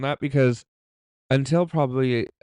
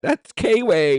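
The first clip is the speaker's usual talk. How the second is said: put-on louder, higher New Zealand accent; Kiwi accent; 9 semitones higher, 7 dB louder, much slower than usual. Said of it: "you do a good all New Zealand accent".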